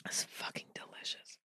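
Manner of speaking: hushed, savoring whisper. Real, not acted. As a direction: whisper with delight. Whispered after sip.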